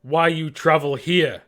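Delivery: slow, loud demand in broken speech; big guy questioning intruders; challenging native. Acted. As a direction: confront the strangers, slowly and loudly. Plays a challenging native figure.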